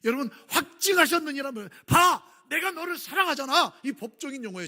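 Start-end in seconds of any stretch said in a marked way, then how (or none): 1.9-3.8 loud, higher, slow declaration; God shouting his love at the world; shouting proclamation. Acted. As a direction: cry it out like a verdict. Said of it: "라고 외치는 것과 똑같은 거예요".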